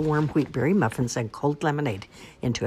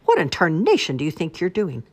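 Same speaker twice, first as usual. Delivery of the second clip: raised, loud scolding demand; indignant farmer; 4 semitones higher, 6 dB louder than usual. A narrator's reading. Farmer confronting the sneaking wolf, indignant. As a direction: demand an answer, outraged.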